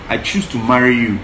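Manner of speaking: ordinary talk, nothing marked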